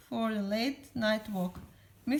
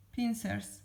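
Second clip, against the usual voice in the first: ordinary delivery, nothing marked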